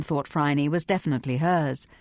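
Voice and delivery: plain reading voice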